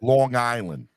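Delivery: low New York "Long Island"; New York accent. Shown as a demonstration. Host proving his New York accent to co-host. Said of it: "that's a good New York accent".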